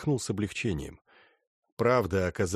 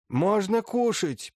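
put-on high, slow commanding old lady; imperious command; 12 semitones higher, 4 dB louder, slower than usual. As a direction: order them to eat, haughtily. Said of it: "приказала Северская".

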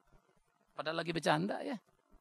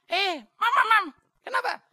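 put-on high, loud excited call of an older woman; mimicking Mami calling; 13 semitones higher, 10 dB louder than usual. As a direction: shout it like a fond auntie spotting you. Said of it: "panggil saya". (menirukan suara Mami memanggil dengan nada tinggi)

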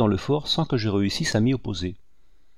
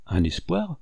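sharp surprised cry; 7 semitones higher than usual. A narrator's reading. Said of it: "s'écria vivement le général".

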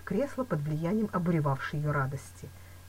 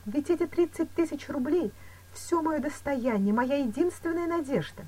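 higher-pitched, lightly voiced woman; dismayed Louise; 10 semitones higher than usual. A narrator's reading. Woman fearing her savings are lost, dismayed. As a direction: lament with understated distress.

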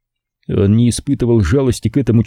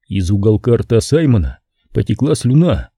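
ordinary delivery, nothing marked

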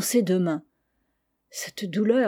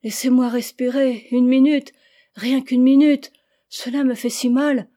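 higher, louder pleading; pained plea; 4 semitones higher, 7 dB louder than usual. Acted. Patient begs for a minute to breathe, pained plea. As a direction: beg for a moment's relief. Sick child pleads in pain.

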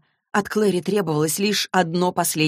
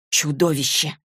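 ordinary delivery, nothing marked

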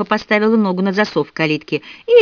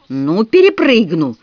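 slow, higher-pitched threat; threatening promise; 6 semitones higher, 4 dB louder, slower than usual. A narrator's reading. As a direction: draw out each threat as a taunt. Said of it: "пообещала Милли". (протяжно, с угрозой)

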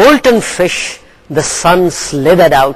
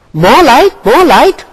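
loud, higher shout; 7 semitones higher, 6 dB louder than usual. A narrator's reading. Raven shouting at Gull for more light. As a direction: bellow the demand. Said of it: "shouted Raven".

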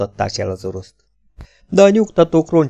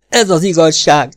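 higher and louder, insisting he is telling the truth; shouted protest; 6 semitones higher, 6 dB louder than usual. Acted. Kocsubej shouts at Szól that he is telling the truth, loud. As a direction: shout it out, fed up with doubt. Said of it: "kiáltotta Kocsubej".

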